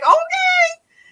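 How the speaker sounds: 驚き呆れた高い「オーケー」; bemused okay. Retold or imitated. Her own reaction to friend.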